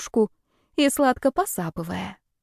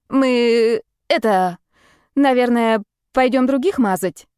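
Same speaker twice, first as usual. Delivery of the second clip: halting, hesitant, a little raised in pitch; unsure; 3 semitones higher, 4 dB louder, slower than usual. A narrator's reading. Rita excusing herself to go prank others, unsure and halting. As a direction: stall between words as if making up an excuse. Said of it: "неуверенно переглянувшись с Альбиной".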